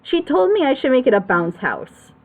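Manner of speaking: ordinary talk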